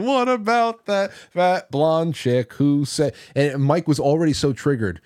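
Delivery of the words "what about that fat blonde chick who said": imitating Anthony. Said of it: "Anthony said".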